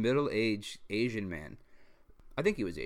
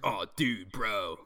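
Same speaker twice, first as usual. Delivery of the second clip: lightly put-on frat-bro voice; a hint of drunken bro talk; acting hetero bro; much slower than usual. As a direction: play a straight dude-bro, slightly hammed. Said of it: "really trying to act, like, hetero".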